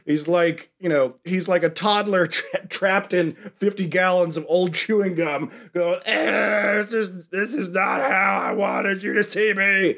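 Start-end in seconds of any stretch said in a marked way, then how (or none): 6.0-10.0 a hammed-up wail of a melted victim; mock crying goo man. Podcaster voicing melted Paul as a hammed-up crying toddler. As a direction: whine it like a trapped toddler. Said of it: "screaming with his hand out".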